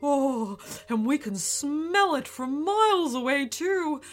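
loud, giddy creature raving; plant eating dinosaur. Acted. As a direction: swoon over every flavour. Kevin raves about ice cream.

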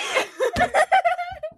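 high loud shriek of laughter. Real, not acted.

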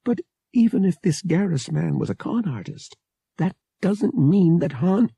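high, pleading protest. A narrator's reading. Bria defending Han to Pavak, protesting. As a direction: argue back in a high voice.

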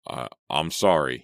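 ordinary delivery

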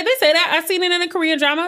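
high, fast, giddy quote; high mock self. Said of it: "I'll be like".

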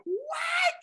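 very high-pitched squeal of disbelief; astonished reaction. Retold or imitated. Speaker retelling her reply to the screaming players, high astonished squeal. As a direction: squeak it out, acting stunned. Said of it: "And I'm like".

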